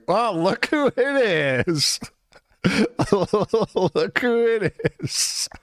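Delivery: high, very slow sarcastic sing-song; mock greeting. Streamer replaying his reaction to her, high slow taunt. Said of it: "was like".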